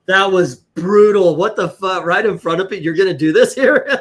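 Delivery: half-laughing loud mock outrage; stunned outburst. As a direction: burst out in disbelief. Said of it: "I was like".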